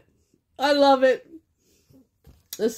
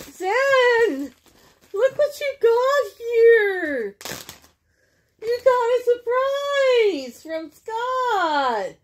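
high, slow sing-song to the dog; pet talk; 11 semitones higher, much slower than usual. Real, not acted. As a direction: coo at the dog. Talking to her dog Zen.

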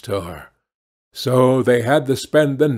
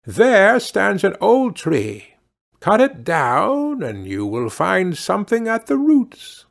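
put-on a higher, unfamiliar little old man's voice; little grey man; 5 semitones higher than usual. Little grey man promises luck to kind Dumpling, higher, kindly.

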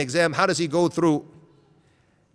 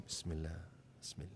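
mock pious murmur; 12 semitones lower, 24 dB quieter, faster than usual. Imitating the humble man.

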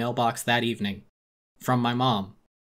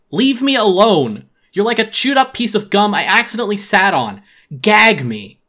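loud, higher, disgusted yell; furious duck screaming at Billy; shrieking rebuff; 9 semitones higher, 11 dB louder than usual. Acted. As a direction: shove him away with a shriek. Pate shouts at Billy.

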